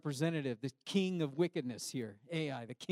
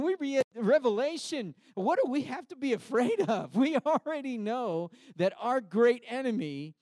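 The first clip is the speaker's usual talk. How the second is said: high, loud, impassioned sermon voice; fiery preaching; 6 semitones higher, 6 dB louder than usual. Acted. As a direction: preach it at full force. Preaching at full force.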